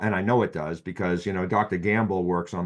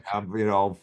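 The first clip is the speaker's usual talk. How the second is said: normal talking voice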